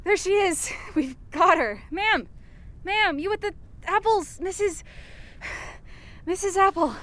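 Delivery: hesitant calling out to the lady. Real, not acted. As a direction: call after her, nervous.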